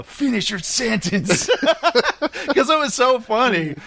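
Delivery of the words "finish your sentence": mock dubbed-cop line, higher than usual; hammed-up cop catchphrase; imitated movie cop. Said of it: "you would always do that. You go".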